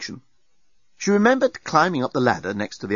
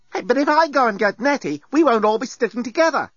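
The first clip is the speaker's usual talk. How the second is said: put-on higher, argumentative young woman; Lucy's voice; 12 semitones higher than usual. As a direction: point out the flaw, pitched up. Lucy arguing with Dan.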